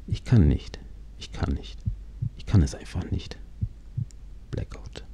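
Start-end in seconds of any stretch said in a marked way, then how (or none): 1.2-3.4 fading from voice to a bare whisper; whispered giving up. Acted. Injured rider too weak to move, whispering. 4.5-5.0 a breathy, very soft whisper; whispered fade-out. Acted. Narrator losing consciousness after the crash, whispering.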